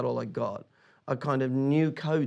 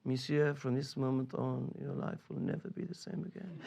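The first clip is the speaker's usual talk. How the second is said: security guy; 9 dB quieter than usual. Retold or imitated. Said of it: "And he says".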